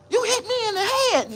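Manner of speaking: mocking the man who swung the paddle in a high whine. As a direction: squeak out the man's weak excuse.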